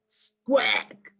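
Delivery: bird squawk. A narrator's reading. Alien creature squawks.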